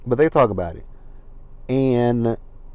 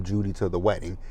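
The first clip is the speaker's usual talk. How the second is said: normal talking voice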